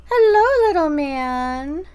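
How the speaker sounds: high, sweet hello to a pet; tender pet greeting. Real, not acted.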